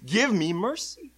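slow, high, mildly pitiful begging; pitiful plea. Acted. Blind beggar begging Jesus for mercy, mild pitiful cry. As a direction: plead pitifully, drawing it out. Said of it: "he's literally crying pitifully".

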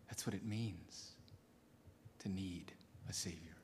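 soft, low, whispered reverence; hushed whisper. Acted. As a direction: draw them in with a near-whisper. Hushed whispered close of sermon.

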